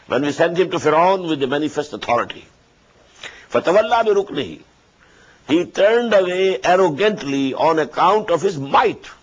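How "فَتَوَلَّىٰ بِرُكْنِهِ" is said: rapid recitation, pitched higher; raised quick recitation. Acted. Teacher repeating the verse higher and faster. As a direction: recite the verse fast with a lifted voice. Quran recitation in tafsir lesson.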